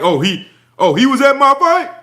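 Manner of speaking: mock innocent surprise, rising at fight; fake surprise. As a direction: play dumb, acting shocked he came. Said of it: "Terrence Crawford wanna act like".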